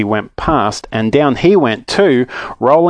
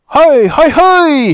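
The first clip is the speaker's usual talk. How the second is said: loud, very high greeting holler; excited yell; 7 dB louder, much slower than usual. Acted. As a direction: yell hello from afar, high and thrilled. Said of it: "he yelled".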